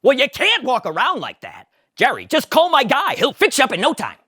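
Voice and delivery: high, loud Kramer; pushy Kramer. Acted. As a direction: sell him on your guy. Kramer advising.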